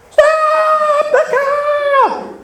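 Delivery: drawn-out high loud scream. Retold or imitated. Wife in back seat screaming at driving husband, loud. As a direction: yell it as a stretched-out panicked cry. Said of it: "she screams".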